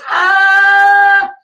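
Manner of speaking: a sudden, very high, loud "Ah!" scream; child's wail. Retold or imitated. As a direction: wail like a toddler who just fell. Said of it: "the kid goes".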